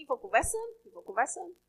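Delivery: ordinary talk, nothing marked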